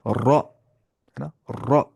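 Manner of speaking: "रॉ" with a thick, full-mouthed R; Tajweed heavy ra on "रॉ". Shown as a demonstration. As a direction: say "रॉ" heavy, rounded toward aw. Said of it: "मोटी आवाज़ से".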